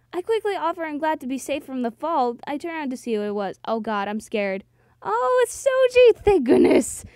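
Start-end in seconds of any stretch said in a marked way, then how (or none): 5.0-7.0 loud cry of relief, starting high. Acted. Heroine finding her friend, loud acted relief. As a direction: burst out with relief.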